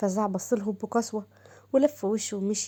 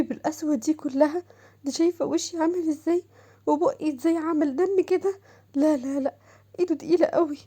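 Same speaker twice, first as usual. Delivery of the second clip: put-on higher, pleading voice of an upset young woman; hurt young wife; 4 semitones higher than usual. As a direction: beg the aunt, close to tears. Shams complains to aunt. (صوت زوجة شابة مجروحة تتوسل)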